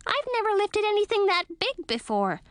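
high tiny creature chatter; squeaky bat voice. A narrator's reading. Lil the bat's voice.

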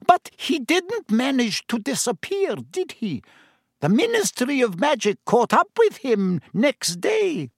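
a hint of smug barmaid relish; satisfied gossip. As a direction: deliver the news like savoured gossip. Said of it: "said with some satisfaction".

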